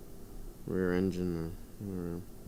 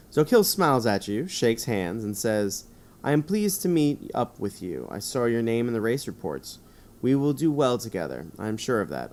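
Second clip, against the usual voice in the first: 1 reading Zokil scene; 4 dB louder than usual. Acted. Reads narration and Zokil's lines.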